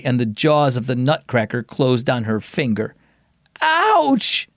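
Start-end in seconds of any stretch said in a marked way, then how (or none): 3.6-4.5 high pained yelp; wail. Acted. Girl hurts her finger and wails, high. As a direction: cry out as the nutcracker pinches. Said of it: "she wailed".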